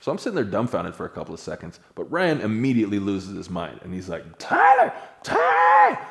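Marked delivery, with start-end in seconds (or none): 4.4-6.0 high, loud screams of a name; panicked screaming. Retold or imitated. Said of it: "as he's sitting there screaming".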